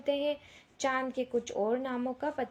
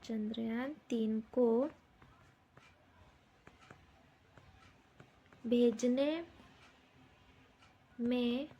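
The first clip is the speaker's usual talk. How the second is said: a few words at a time, long gaps; slow dictation; 4 dB quieter, slower than usual. Shown as a demonstration. Teacher giving children the second question to copy, slow dictation. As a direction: dictate piece by piece so pupils can write.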